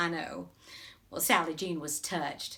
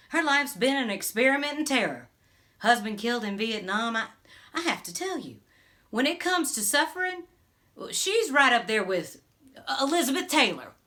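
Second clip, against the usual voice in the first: dramatic gossip; 5 semitones higher, 6 dB louder than usual. Acted. Dramatic gossip about a neighbour.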